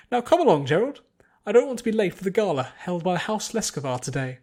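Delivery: high, loud haughty complaining; looking down on every shop in town; snooty pretentious lady. A narrator's reading. Spoiled noblewoman griping to her servants, high and loud. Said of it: "a snooty, pretentious voice".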